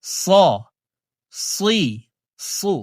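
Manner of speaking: thick, emphatic "স, সি, সু"; heavy Arabic sad. Shown as a demonstration. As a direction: say "স, সি, সু" with a heavy, full s, not a thin seen. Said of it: "সদকে মোটা করে উচ্চারণ". ("স, সি, সু" মোটা করে উচ্চারণ)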